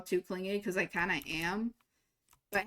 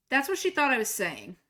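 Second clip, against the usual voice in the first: ordinary delivery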